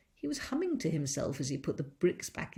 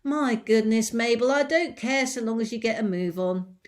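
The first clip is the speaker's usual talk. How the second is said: loud, higher exasperated order; impatient mistress; 5 semitones higher, 11 dB louder than usual. Acted. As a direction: hurry her up, exasperated. Mrs Bean impatient.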